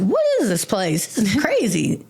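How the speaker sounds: rising, incredulous "what is this place"; surprised disbelief. Retold or imitated. Newcomer gawking at dogs in rehab, incredulous.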